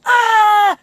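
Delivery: loud high yell from the top of the lungs; rooftop yell. Shown as a demonstration. Showing how people yell from rooftops, loud high shout. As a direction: scream it off a rooftop to let off steam. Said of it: "They go".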